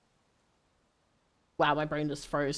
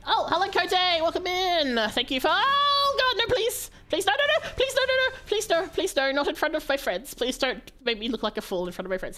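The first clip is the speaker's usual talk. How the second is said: mock panic; 11 semitones higher than usual. Panicked high pleading at raid.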